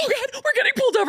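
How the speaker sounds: high, fast mock panic; panicked self-quote. Mom panicking as cop pulls her over. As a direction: squeal it out in a laughing panic. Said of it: "I'm like".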